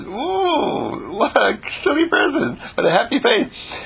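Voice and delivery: high, put-on voice of onlookers; teasing onlookers. Everybody mocking the happy face, high and amused. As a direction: tease like amused neighbours. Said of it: "everybody says".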